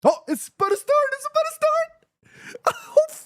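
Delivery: very high, thrilled squeak; excited squeal. Real, not acted. Fan sees the match about to start, squealing with excitement. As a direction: squeal it, can hardly wait.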